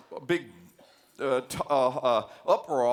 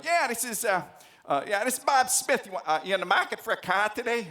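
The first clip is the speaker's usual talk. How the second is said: put-on Boston salesman accent; 7 semitones higher than usual. Bob Smith answers the lecturer's grad student, Boston accent. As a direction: play the Boston car dealer answering the phone, higher. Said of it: "That's how they talk in Boston".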